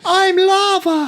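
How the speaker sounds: very high, drawn-out fake child voice; mock kid's voice. Mimicking the dubbed boy playing rock paper scissors, mock kid's voice. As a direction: squeak it out like an adult badly dubbing a little boy. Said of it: "an adult doing a kid's voice".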